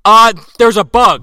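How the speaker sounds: loud, high mock excuse; caught-out blurt. Father caught by Sharon, loud mock excuse. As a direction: blurt it out in hammed-up panic. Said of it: "He says".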